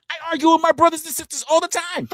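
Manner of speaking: high, whiny mocking voice; mock chatter. Streamer mimicking a chatter bragging about arguing. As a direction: whine it like the defensive chatter.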